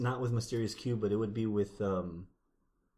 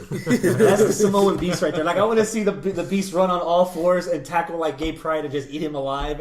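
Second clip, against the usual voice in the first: laughing; 5 semitones higher, 9 dB louder than usual. Real, not acted. Laughing, excited riff.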